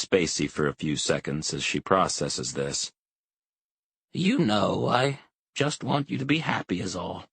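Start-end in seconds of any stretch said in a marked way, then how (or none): 4.1-7.3 a hint of a tender old mother, higher; lightly voiced old mother. A narrator's reading. Elderly mother to her son, gently wishing him happiness.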